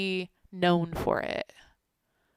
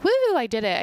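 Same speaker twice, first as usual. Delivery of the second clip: jazzed cheer; 5 semitones higher, 6 dB louder than usual. Retold or imitated. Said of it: "super jazzed and like".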